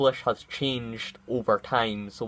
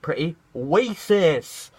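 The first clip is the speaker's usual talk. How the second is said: slow, higher, put-on whiny voice; mocking SJW; 6 semitones higher, 5 dB louder, slower than usual. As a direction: parody an offended activist. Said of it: "as the S J Ws call".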